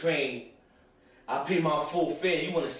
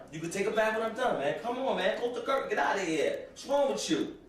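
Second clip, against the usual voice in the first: put-on high, annoyed young boy pushing brother off; whiny fourth-grader telling him to get out; lightly voiced kid complaining; kid self protesting; 7 semitones higher than usual.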